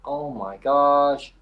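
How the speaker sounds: slow, drawn-out mock dismay; shocked politician. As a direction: stretch out the gasp of fake shock. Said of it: "He goes".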